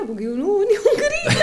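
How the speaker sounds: high-pitched protest breaking into laughter; laughing protest. Real, not acted. Sister contesting her brother's version of the morning, laughing and high. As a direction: deny it through real laughter. Laughing denial during playful dispute.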